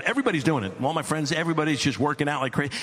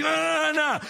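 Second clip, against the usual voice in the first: put-on kid's protest; 13 semitones higher than usual. Kid protests goggles ripped off.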